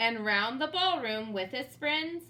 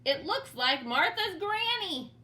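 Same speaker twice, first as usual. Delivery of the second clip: roared; 7 semitones higher, 3 dB louder than usual. Acted. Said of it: "he roared".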